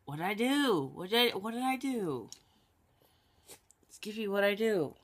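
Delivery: high cooing voice for a dog; playful pet talk. Real, not acted. Owner asking Skippy after taking the collar off, playful pet talk.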